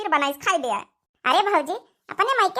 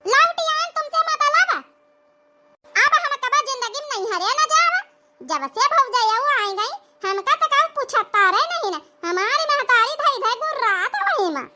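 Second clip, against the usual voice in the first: indignant snap; 7 semitones higher than usual. Acted. Daughter snaps at neighbour.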